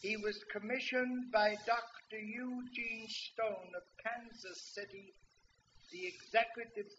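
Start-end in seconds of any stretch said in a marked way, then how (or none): none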